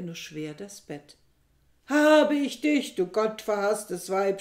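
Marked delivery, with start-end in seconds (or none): 1.9-4.4 shouting. A narrator's reading. Aristippos storming into the dark room, a furious shout. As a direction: bellow it at the woman he has caught in bed. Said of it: "schrie er".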